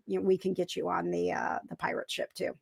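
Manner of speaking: conspiratorial whisper. Real, not acted. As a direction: hush it like a secret. Teasing about the pirate ship.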